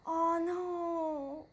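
high, drawn-out worried moan; dismayed. Real, not acted. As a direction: let a long, high oh-no out in dread. Dread at game's battery warning.

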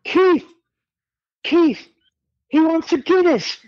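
high-pitched call across the room; very high, imitated shout-out. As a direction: imitate hollering to a mate. Retelling calling across to Keith.

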